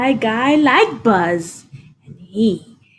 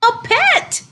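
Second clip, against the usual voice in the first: excited cry; 14 semitones higher than usual. Acted. Boy catches fly, delighted.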